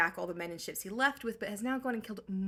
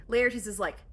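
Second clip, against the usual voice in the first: normal talking voice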